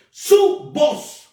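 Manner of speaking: su voz pointed, singular stressed. Shown as a demonstration. Preacher contrasting su voz with voces, emphatic. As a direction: drive home su voz, one voice. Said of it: "no voces".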